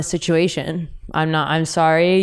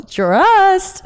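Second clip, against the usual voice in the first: high, loud drawn-out squeal; hammed-up sassy sign-off, pitched way up; 12 semitones higher, 5 dB louder than usual. Telling her date someone else will kiss her, sassy squeal.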